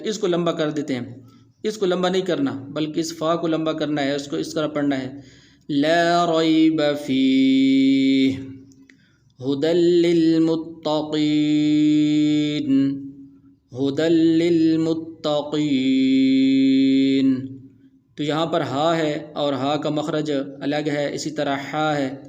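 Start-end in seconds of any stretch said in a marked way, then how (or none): 5.7-17.5 very slow melodic chant; Quran recitation. Shown as a demonstration. Teacher reciting the verse to students in slow melodic tajweed. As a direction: sing out the verse slowly, stretching the vowels.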